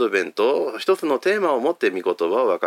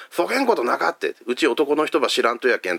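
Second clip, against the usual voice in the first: higher-pitched, protesting Hakata Mary; Mary in Hakata dialect; 6 semitones higher than usual. Pretend. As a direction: play Mary brushing it off in thick Hakata dialect. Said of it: "あれもなまってると面白い". (博多弁で否定するマリア)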